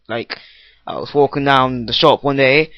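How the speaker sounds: usual speaking voice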